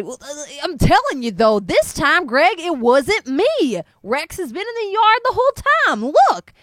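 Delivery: loud, high and pressing; insisting neighbor. Acted. Frank insisting to Greg the dog was home, loud. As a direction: prove him wrong.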